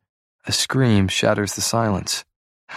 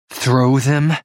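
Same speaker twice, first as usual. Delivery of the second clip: incredulous; 5 semitones higher, 4 dB louder than usual. Acted. Blair incredulous.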